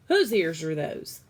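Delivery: loud demanding question. Acted. Cook demanding to know whose ears, loud. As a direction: call it out loud and sharp.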